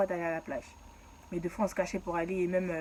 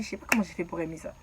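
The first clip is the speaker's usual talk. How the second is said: normal talking voice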